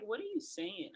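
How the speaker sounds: high, soft imitation of a confused coach; baffled questioning. Coach asking a player who is screaming on court, high mock bafflement.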